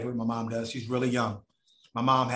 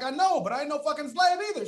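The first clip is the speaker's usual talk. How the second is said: heated retort; 13 semitones higher than usual. Retold or imitated.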